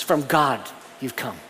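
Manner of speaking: "From God" pressed, "you've come" dropping low and soft; emphasis on from God. Shown as a demonstration. Preacher repeating the emphatic order, stressing from God. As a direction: land on "From God", then let it fall. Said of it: "That's the emphasis".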